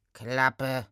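low, curt snort to shut up; snorted command. Acted. Centaur silencing the gnome, snorting. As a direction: cut the chatter off with a low snort. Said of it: "schnaubte Remus".

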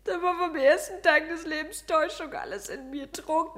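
anguished confession to her friend; agitated outpouring. Acted. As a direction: pour out her guilt. Anguished self-accusation.

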